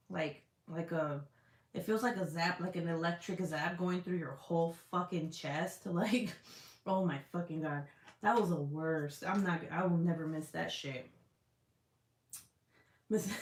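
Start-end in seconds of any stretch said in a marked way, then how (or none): none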